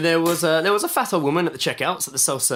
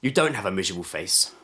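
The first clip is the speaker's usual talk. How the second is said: softer, lower and kind; reassuring; 10 semitones lower, 4 dB quieter than usual. Retold or imitated. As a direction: gently comfort her. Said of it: "looked at her and just went".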